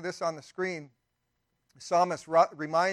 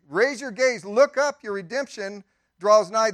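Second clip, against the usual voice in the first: preaching it louder; 3 semitones higher, 7 dB louder than usual. Real, not acted. Pastor exhorting the congregation, louder and higher. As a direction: raise your gaze, called out louder and higher.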